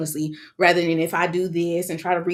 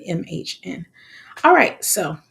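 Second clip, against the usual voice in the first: usual speaking voice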